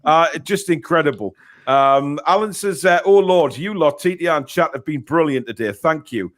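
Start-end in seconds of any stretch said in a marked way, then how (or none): none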